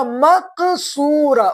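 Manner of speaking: مقصورہ drawn out slowly, each syllable clear; slow spelled-out word. Shown as a demonstration. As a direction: stretch مقصورہ like a teacher to a class. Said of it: "کیا لکھا؟".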